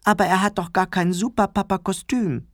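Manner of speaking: put-on plaintive, high kid voice; whining child. A narrator's reading. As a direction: whine it like a small child. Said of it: "jammerte Clemens".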